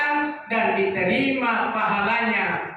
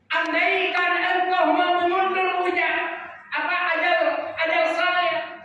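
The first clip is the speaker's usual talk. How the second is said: higher, pleading preacher's voice; raised-voice plea; 9 semitones higher than usual. Acted. Dying man begs Allah for more time, pleading higher. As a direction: plead it higher, as one near death. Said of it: "dia mengatakan terhadap Allah".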